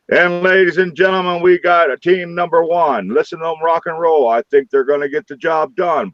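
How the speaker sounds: hammed-up announcer pitch; mock announcer. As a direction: call it like a ringside announcer. Mock announcer for his recording.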